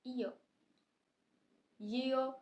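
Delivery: ordinary delivery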